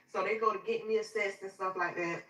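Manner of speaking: plain reading voice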